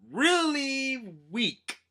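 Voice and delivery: "really weak" stretched slow and high; mocking, high-pitched drag on "really weak"; drawn-out emphasis. Real, not acted. Mocking how weak knights are.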